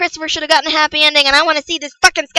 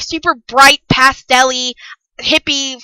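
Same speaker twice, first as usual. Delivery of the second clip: ordinary talk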